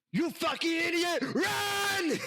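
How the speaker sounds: high-pitched frantic shout; panicked character yell. Acted. Game master as Coconut shoving you off, high shout. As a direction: yell it high, urging them to flee. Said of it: "you hear him go".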